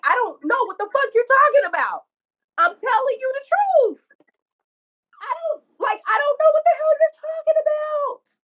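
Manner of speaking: very high, squealed re-enactment; imitated high-pitched upset protest; retold desperate denial. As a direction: act out pleading innocence through tears. Re-enacts crying denial of accusation.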